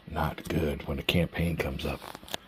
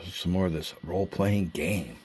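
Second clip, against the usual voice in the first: normal talking voice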